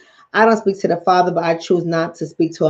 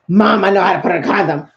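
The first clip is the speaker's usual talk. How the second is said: mock teenage protest to mom; imitating embarrassed son; 4 dB louder, faster than usual. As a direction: protest like a mortified kid cutting mom off.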